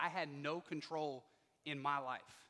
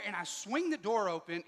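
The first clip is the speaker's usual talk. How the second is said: usual speaking voice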